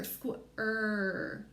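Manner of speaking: ordinary talk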